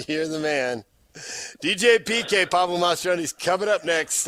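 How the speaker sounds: ordinary delivery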